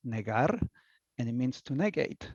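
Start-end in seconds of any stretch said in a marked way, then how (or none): 0.1-0.6 NEGAR said the Spanish way, neh-GAR; Spanish pronunciation. Shown as a demonstration. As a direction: pronounce NEGAR as a Spanish word. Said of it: "the pronunciation is".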